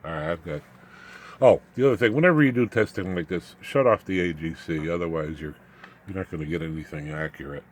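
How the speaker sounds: usual speaking voice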